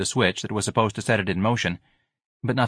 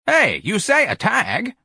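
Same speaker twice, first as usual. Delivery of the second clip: high, slow drawl; a crowd calling back; workers answering; 13 semitones higher, 4 dB louder, slower than usual. Acted. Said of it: "several voices answered".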